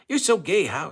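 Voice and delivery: high, loud mocking squeal of a quote; mock angry outburst. Host imitating Angry Alice's rant, high and loud. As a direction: mimic her unhinged snap. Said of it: "she was nuts".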